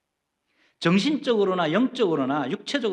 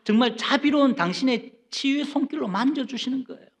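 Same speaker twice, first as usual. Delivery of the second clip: normal talking voice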